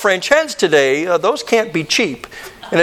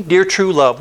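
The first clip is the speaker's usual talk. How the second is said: usual speaking voice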